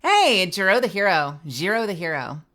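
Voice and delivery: loud, flat android greeting; a stiff robotic hello; Zentreya voice. Acted. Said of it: "I'm glad you like my impression".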